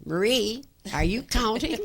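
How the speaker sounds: high, loud call from afar; imitated holler. Sister checking on her piano pupil from the far corner, imitated holler. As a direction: mimic a teacher hollering across the building. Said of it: "and she'd holler".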